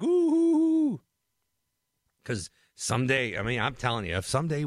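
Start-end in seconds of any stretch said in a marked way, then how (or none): 0.0-1.0 mock impressed ooh. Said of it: "the N F L is all like".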